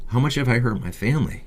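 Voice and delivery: usual speaking voice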